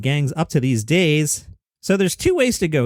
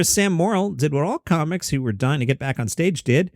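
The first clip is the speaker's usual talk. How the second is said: normal talking voice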